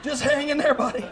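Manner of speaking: high, lightly voiced mom; a hint of a mother's coaxing voice; mom soothing. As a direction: imitate the wife comforting her son. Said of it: "she was going".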